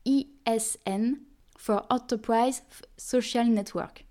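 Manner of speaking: slow, soft English with a French accent; careful French-accented English, read slowly. Real, not acted. Podcast host apologising for her English, slow French-accented English. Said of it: "merci de ne pas vous moquer de mon accent".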